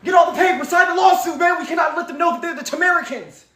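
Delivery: high, hammed-up panicked calling out; mock frantic orders. Mocking opponents rushing to sign lawsuits, high frantic shouts. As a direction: play a flustered official rushing the paperwork. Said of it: "They signing shit".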